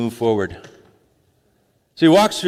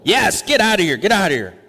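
higher and louder, shooing them out; exasperated shout; 5 semitones higher, 3 dB louder than usual. Acted. Pharaoh yelling at Moses to get out, fed up shout. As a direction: yell it like you want them gone now.

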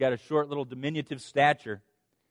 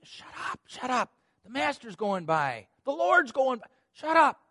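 hushing scold; 8 semitones higher than usual. Acted. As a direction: shut him up fast. Crowd hushing the blind man.

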